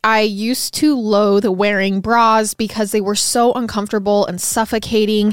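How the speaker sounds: upbeat, loud sponsor read. Acted. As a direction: sell it like a hosted podcast ad.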